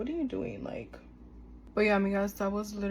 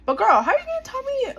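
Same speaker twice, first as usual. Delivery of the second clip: exasperated outburst; 10 dB louder, faster than usual. Real, not acted. Telling friends about a rude shopper, loud outraged disbelief.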